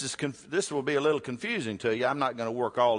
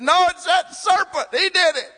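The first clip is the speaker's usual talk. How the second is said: high, loud protesting denial; whiny Eve; 9 dB louder than usual. Retold or imitated. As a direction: point the finger at the serpent in a high voice. Preacher acts Eve blaming serpent.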